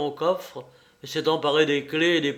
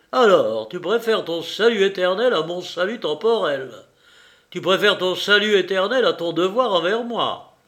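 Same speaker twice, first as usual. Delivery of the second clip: louder, higher indignant rebuke; indignant reproach; 6 semitones higher, 6 dB louder than usual. A narrator's reading. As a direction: throw the reproach at her. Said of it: "croisa ses bras indignés".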